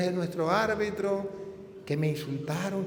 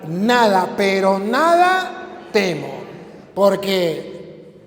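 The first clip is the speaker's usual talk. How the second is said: proclaimed psalm; 4 semitones higher, 8 dB louder than usual. Acted.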